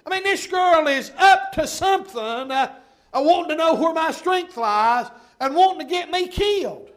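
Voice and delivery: high, loud pulpit cry; full-force preaching. Acted. Preacher airing his suspicion of the girl to the congregation, loud preaching.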